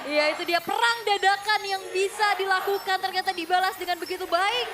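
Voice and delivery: excited. Real, not acted. Caster reacts to fight.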